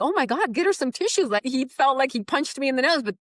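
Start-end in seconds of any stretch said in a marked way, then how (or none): 0.0-1.3 flustered boss. Retold or imitated. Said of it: "he's just like".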